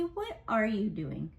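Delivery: ordinary talk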